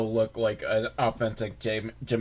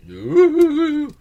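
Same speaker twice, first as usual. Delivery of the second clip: high, louder mock-eerie ooh; spooky ooh; 7 dB louder than usual. Real, not acted. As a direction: ooh like a ghost story. Hobgoblins are out there.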